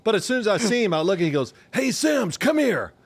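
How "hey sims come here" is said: high, sing-song "Hey, Sims" with a threat under it; the head coach calling his quarterback over, sweetly menacing; ominous coach summons. Retold or imitated. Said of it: "I could hear the tone of the voice".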